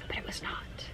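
barely audible, voiceless letdown; deflated whisper. Real, not acted. Gamer after a failed shiny hunch, deflated whisper. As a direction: let it drop into a whisper.

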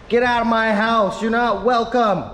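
loud, high yelling; shouting match. Real, not acted. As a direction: yell the crab out of the house. Yelling at crab in house.

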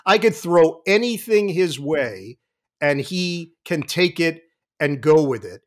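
ordinary talk

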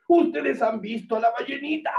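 hunter's high, insistent question; hunter calling out. Acted. Hunter asks audience again.

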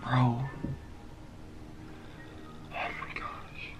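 soft trembling whisper; real fear, whispered; shaken whisper. Real, not acted. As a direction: still scared, hushed. Shaken after the scare.

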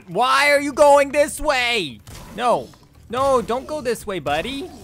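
loud, high-pitched yell of frustration; exasperated shout. Real, not acted. Player to wayward game companion, exasperated high shout. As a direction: yell after someone heading the wrong way. Escort walks the wrong way.

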